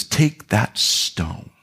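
low, very slow, hushed command; Jesus commanding. Acted. Preacher voicing Jesus at Lazarus's tomb, hushed.

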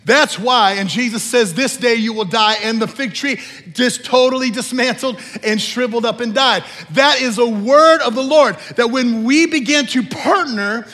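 loud, rising exhortation to the church; preaching at full force. Real, not acted. Preacher urging the church to nurture God's word, loud and fervent. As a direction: push every line out to the congregation.

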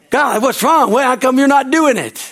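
high-pitched, exasperated whine to God; mock complaint. Voicing impatient believer questioning God.

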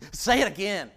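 a hint of a hyena relishing the scare; nervous hyena thrill. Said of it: "They like being kinda nervous".

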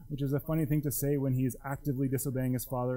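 normal talking voice

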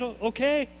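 high, smug brush-off; mock self-assured. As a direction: play the cocky know-it-all. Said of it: "you think you got it".

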